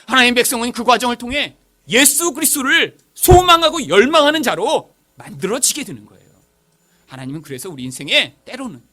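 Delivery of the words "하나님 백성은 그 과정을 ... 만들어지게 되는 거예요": loud, raised pulpit proclamation; forceful preaching. Real, not acted. Preacher tells the congregation what suffering makes, forceful proclamation. Preacher driving home the main point.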